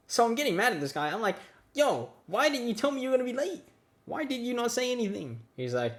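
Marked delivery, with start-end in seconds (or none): 1.7-5.4 confronting. Retold or imitated. Confronting late friend.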